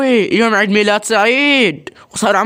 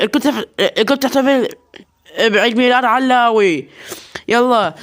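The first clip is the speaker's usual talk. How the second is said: normal talking voice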